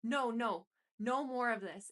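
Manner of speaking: shouting. A narrator's reading. Narrator shouting at Sean, ending it for good. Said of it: "I shouted at him".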